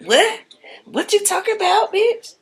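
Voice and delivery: very high disbelieving squeal; shocked Stephanie. Retold or imitated. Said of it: "Stephanie like".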